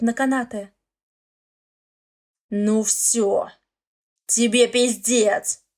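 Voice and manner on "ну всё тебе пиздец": slow rasping menace; hoarse threat. Acted. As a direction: wheeze it out as a threat. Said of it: "хрипит он".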